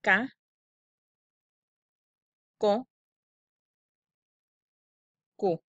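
"K, ko, ku" each said apart with a pause; k syllables. Shown as a demonstration. Teacher drilling k syllables for the class. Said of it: "las sílabas de el sonido k".

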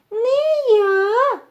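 very high mouse squeak; second little mouse refusing. A narrator's reading. Second mouse refuses the work too, high squeak. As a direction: pipe a drawn-out, tiny refusal. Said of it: "каже й друге мишеня".

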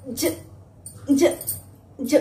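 ज repeated softly, as if wobbling like jelly; playful ज ज ज like a shaking jelly; wobbly jelly j. Shown as a demonstration. Teacher showing the jelly action sound. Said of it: "वो ऐसे ऐसे हिलेंगे".

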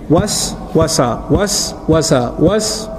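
slow, syllable by syllable: "Was, wasa"; syllables split apart. Shown as a demonstration. Teacher breaking a word into syllables for listeners, slow and deliberate. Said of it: "You see the two syllables together?".

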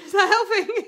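high laughing question. Real, not acted. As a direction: ask it through laughter. Laughing at her hair.

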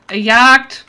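loud, high urgent alarm; panicked warning. Real, not acted. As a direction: warn them fast. Repeats warning.

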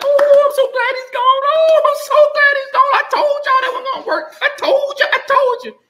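very high, loud mocking falsetto; gloating haters. Mocking the haters who cheer the coach's firing, in a high gloating squeal. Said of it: "haters are gonna be jumping for joy like".

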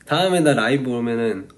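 usual speaking voice

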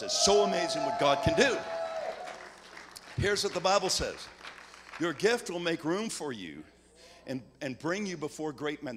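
preacher urging the congregation loudly to sow money; full-force preaching. Acted. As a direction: preach it to the crowd, driving hard. Preaches the offering appeal at full force.

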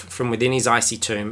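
normal talking voice

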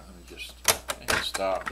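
ordinary delivery

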